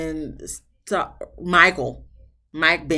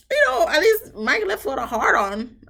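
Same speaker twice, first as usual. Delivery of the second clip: teasing; 11 semitones higher, 4 dB louder than usual. Real, not acted. Joke punchline.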